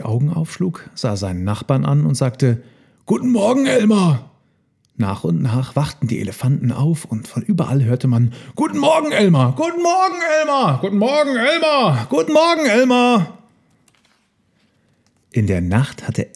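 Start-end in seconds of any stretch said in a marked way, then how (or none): 3.0-4.3 high, singing-out elephant voice; cheerful greeting. A narrator's reading. Waking elephant greets its neighbour, high-pitched call. 8.6-13.4 very high elephant greetings; chorus of greetings. A narrator's reading. Elephants waking all around greet each other, high calls.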